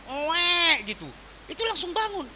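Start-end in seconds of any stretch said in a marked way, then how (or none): 0.1-0.9 a small mock wail of a thirsty child; imitated baby cry. Child crying for a drink, mimicked by the pastor. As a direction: cry out like a baby waking in the night. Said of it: "begitu anaknya ngomong".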